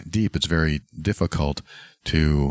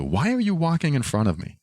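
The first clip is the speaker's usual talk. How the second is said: serious accusing question; 8 semitones higher than usual. Retold or imitated. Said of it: "It wasn't a joke. It was meant to be serious".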